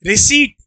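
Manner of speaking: रिसीट modelled with silent P; teacher's demo of रिसीट ending in a plain T; correct pronunciation. Shown as a demonstration. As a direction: say रिसीट with long "ee", no P. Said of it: "पी नहीं पढ़ते".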